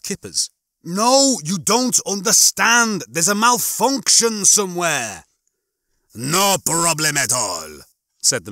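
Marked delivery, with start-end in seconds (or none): 0.8-5.3 high, slow, emphatic complaint; frustrated insistence, stretched words; exasperated protest. A narrator's reading. Lister argues with the dispensing machine. 6.1-7.8 slow, drawn-out machine voice; an unhurried automated server, oblivious to complaints; obliging machine. A narrator's reading. Dispensing machine's voice, cheerfully oblivious.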